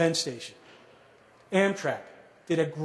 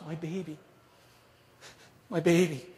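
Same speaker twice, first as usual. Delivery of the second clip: retold sob. Mom hugging her returned child on the stoop, mildly imitated sob. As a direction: lightly act a tearful mother, first words hushed. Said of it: "and sobs".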